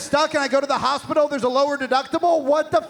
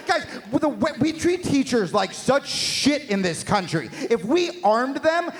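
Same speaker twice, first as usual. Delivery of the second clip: normal talking voice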